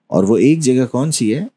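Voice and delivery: ordinary talk, nothing marked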